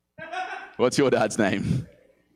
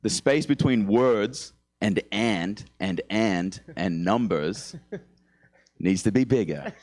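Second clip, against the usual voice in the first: very slow, savouring each and; slow mock-pedantic reread; slow repeat; 2 semitones lower, much slower than usual. Shown as a demonstration. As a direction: drag it out word by word. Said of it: "repeating own line slowly".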